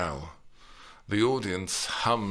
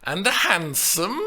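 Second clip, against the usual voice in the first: stretched-out words; 9 semitones higher, 5 dB louder than usual. A narrator's reading. Nurse stretches out words to tease Juliet. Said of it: "stretched out the words".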